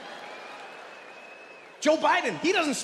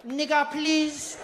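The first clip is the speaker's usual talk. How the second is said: high, very drawn-out mock child voice; cousin chiming in; 4 semitones higher, much slower than usual. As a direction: stretch each word out slowly, high.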